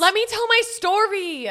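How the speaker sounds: loud, high whiny protest; whiny baby complaint, drawn out; mock whine. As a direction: whine it slowly like an impatient child. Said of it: "You whiny fucking baby".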